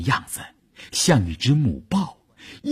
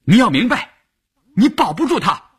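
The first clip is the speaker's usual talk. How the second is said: high-pitched, forceful raised voice; loud warning; 12 semitones higher, 3 dB louder than usual. A narrator's reading. Bai Shufen confronting Wu Yun at the meeting, loud. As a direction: call out a warning, pitched right up. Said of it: "大声地说".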